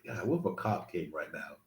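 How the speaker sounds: half-whispered what-if while climbing a fence; hushed worried thought. Retold or imitated. Man over his backyard fence, quietly fearing a cop. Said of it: "I was just thinking about this".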